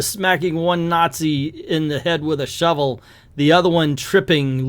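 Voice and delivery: normal talking voice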